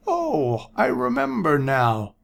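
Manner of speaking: put-on high, dotty old prophet; a rambling old seer; old man voice. A narrator's reading.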